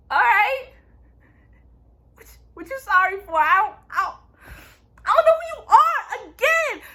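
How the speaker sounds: high-pitched, puzzled mimicking voice; hammed-up bewilderment; mock clueless amnesiac. Mimics Vanya not knowing Luther.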